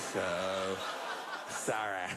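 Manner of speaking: a slight, offhand, fake apology; mock the hollow apology back; insincere sorry. Comic mimicking the agent's fake apology, offhand. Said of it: "The least sincere of all apologies".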